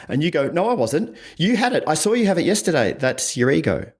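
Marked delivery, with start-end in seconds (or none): 0.5-2.9 higher, quicker defensive denial; defensive justification. Retold or imitated. Partner accused about the peanut butter, defensive denial. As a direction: fire back a denial to justify yourself. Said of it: "that defensive justification type tone".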